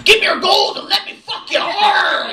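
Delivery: rowdy pirate growl with a laugh. Retold or imitated. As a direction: bellow like a pirate captain. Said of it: "like being married to a pirate".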